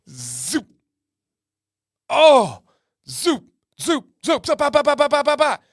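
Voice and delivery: high, rapid buzzing sound effects; hornet attack noises. Retold or imitated. Telling the chat about hornets, high buzzing noises. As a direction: mimic the hornets swarming out.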